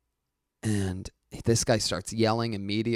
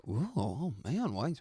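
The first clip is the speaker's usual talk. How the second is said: soft, slow crowd groan; disappointed crowd groan; 3 semitones higher, 8 dB quieter than usual. Retold or imitated. Crowd reacting to the fan being kicked out, soft slow groan. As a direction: groan like a let-down audience. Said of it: "the crowd's sorta like".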